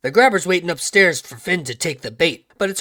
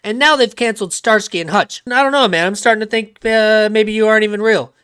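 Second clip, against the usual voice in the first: higher, hammed-up griping; mock exasperated complaint; 6 semitones higher, 4 dB louder than usual. [name] complaining to Jesus in prayer, mock exasperated whine. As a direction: complain upward in put-on exasperation.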